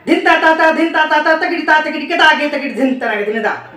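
rhythmic syllables spoken fast and even; rapid bol recitation. Shown as a demonstration. Teacher reciting drum bols for students, rapid chant. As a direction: rattle off the tabla syllables quickly in rhythm. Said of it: "मैं इतना fast कैसे बोल पा रहा हूँ?".

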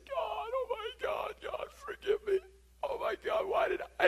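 high crying plea to God; tearful wailing cry for forgiveness; bawling prayer. Retold or imitated. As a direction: sob it out high and broken. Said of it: "I just started bawling".

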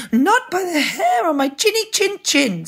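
high, squealing piglet defiance; very high piggy voice; first little pig. A narrator's reading. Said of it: "said the first little pig".

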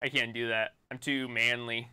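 ordinary talk

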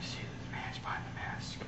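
breathy hushed whisper. Acted. Unmasking the villain in a low whisper. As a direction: whisper it as a secret reveal.

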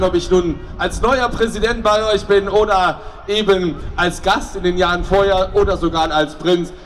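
ordinary talk

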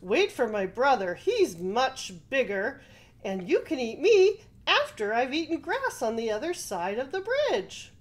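loud, pleading goat voice; middle-sized billy goat. A narrator's reading. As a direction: bargain loudly with the troll. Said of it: "said the middle-sized billy goat".

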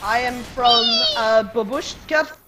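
slow, put-on Slavic accent; hesitant, hammed-up Slavic accent; questionable Slavic accent. Faker inventing a Russian hometown, questionable Slavic accent. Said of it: "jump into my questionable Slavic accent".

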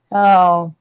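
normal talking voice

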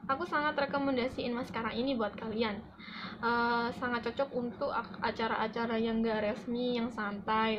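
normal talking voice

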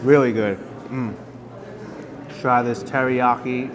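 ordinary delivery

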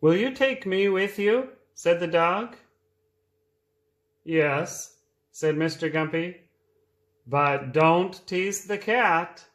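reading a picture book aloud; lively read-aloud for small children; storytime voice. A narrator's reading.

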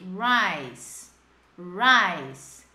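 RICE with the I opened into a full "eye" glide; clear vowel model. Shown as a demonstration. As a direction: model RICE low and loud-edged, vowel drawn out. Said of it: "o I fica com som de I".

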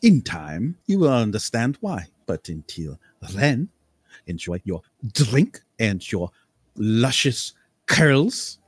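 theatrical, savoring each word like a mentor of the spin; lordly, mysterious eccentric voice; eccentric nobleman. Acted. GM voicing eccentric Lord Cambria.

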